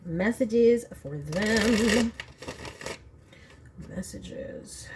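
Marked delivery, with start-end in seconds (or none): 3.9-4.9 breathy whispered single word. Real, not acted. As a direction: whisper it to the cards. Calling messages from spirit.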